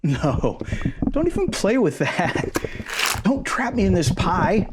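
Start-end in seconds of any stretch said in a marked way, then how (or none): 3.2-4.7 panicked pleading. Acted. Tiny man begs his partner as the crust closes, panicked. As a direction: beg to be let out, voice climbing. Trapped under pie crust top.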